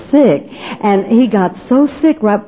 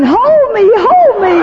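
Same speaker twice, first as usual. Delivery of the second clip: screaming to be held; 12 semitones higher, slower than usual. Retold or imitated. Woman falling on a plane shrieks for help, mock high scream. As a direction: wail it out high like you're tumbling down the aisle. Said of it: "I was just screaming".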